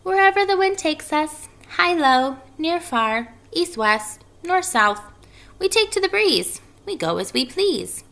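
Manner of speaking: high, bright little spider chanting; sing-song baby spider voice; tiny spider voice. Acted.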